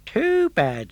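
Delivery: a shrugging farmer brushing him off; Giangio's voice. Acted. Giangio dismisses Pinocchio.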